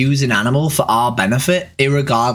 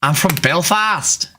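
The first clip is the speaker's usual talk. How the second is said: put-on slow, higher Belfast accent; drawn-out Northern Irish vowels; 4 semitones higher, slower than usual. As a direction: pose as a Belfast local. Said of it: "told to do a Northern Irish accent".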